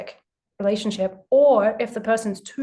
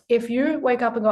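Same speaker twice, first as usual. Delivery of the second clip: ordinary talk, nothing marked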